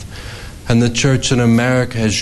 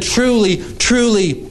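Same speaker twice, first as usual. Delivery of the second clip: "Truly" said high, each falling firmly; emphatic repetition; 9 semitones higher than usual. Acted. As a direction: ring out "truly, truly" like a proclamation. Quoting Jesus emphatically.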